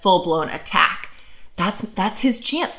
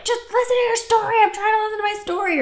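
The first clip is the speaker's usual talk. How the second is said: hammed-up high-pitched exasperation; retold yelling; 14 semitones higher, faster than usual. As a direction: imitate a parent snapping at a small child. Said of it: "I ended up yelling at her".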